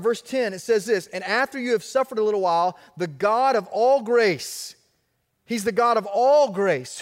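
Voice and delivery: rising sermon fervor; full-force preaching. Real, not acted. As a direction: drive it home to the congregation.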